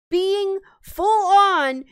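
high, loud and slow, losing her temper mid-sentence; heated outburst. Real, not acted. Streamer ranting at chat about gamers, loud angry burst. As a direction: boil over on the words. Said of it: "I'm popping off".

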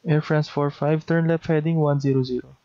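ordinary talk, nothing marked

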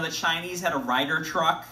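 usual speaking voice

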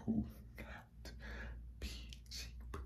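breathy whispered warning, no voice at all. Shown as a demonstration. Dog breeder whispering to followers that litters won't be cheap. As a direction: lean in and whisper the point like a secret.

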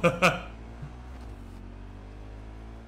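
laughing at their own blunder; laughter. Real, not acted. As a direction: burst out laughing, letting it trail.

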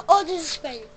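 startled by a spider; startled gasp. Real, not acted. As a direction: gasp then loud cry. Spots spider.

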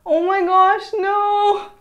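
high, loud cry of panic; horrified outburst; shocked cry. Real, not acted. Cut went wrong, after gasp.

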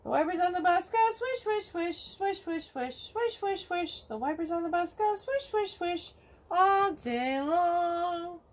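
ordinary delivery, nothing marked

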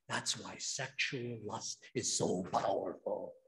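usual speaking voice

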